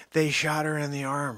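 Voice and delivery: ordinary talk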